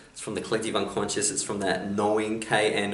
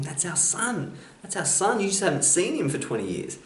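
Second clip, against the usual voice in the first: much higher, frantic mock cry; screaming wife; 9 semitones higher than usual. Wife tells Shiva he killed their son, high-pitched mock scream. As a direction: shriek it like a panicked mother. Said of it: "his wife comes out screaming".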